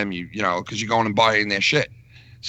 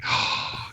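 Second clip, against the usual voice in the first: shocked gasp; 8 dB quieter than usual. Retold or imitated. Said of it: "Shannon's like".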